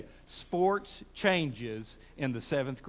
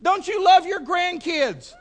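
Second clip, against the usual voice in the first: high, loud, slower shouted question; rousing challenge; 13 semitones higher, 11 dB louder, slower than usual. Real, not acted. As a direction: call it out to rouse the whole room. Pressing congregation for a response.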